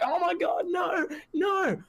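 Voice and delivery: very high mock wail of despair; Punk and Heyman panicking. Fan mimicking champion and manager hearing the ruling, high mock panic. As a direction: shriek it in hammed-up horror. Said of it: "Punk and Heyman are like".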